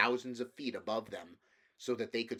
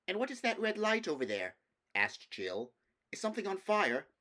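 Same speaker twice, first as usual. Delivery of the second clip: put-on alarmed girl; 10 semitones higher, 3 dB louder than usual. Girl Jill alarmed at red light.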